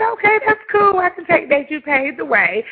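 high, loud, dismissive "okay, that's cool"; sarcastic retort. Retold or imitated. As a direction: brush her off with fake agreement. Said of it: "And I said".

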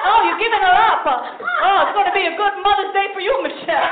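loud, high, mock-offended old lady; guilt-trip her loudly; grandma voice. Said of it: "She said".

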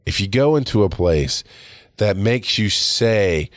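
ordinary talk

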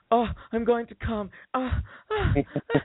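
high, put-on voice with little gasps; hammed-up high moaning; imitated climax. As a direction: mimic a date's moans in a high pitch. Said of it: "he was like".

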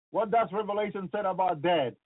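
ordinary talk

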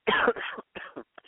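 sudden choking cough; coughing fit. Real, not acted. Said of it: "choking a little bit here".

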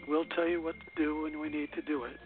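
ordinary delivery

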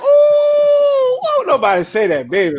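put-on shrieking ooh, then gushing at the accent; mock swooning fan. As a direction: gush like a starstruck fan. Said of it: "You be like".

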